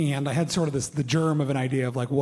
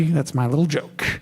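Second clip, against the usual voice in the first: ordinary talk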